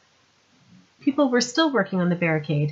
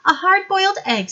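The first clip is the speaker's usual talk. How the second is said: put-on higher, louder young woman, brisk; young woman's voice; 12 semitones higher, 8 dB louder than usual. Sandra explaining to Vimes how she got there, higher young woman voice. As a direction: play her bright and eager.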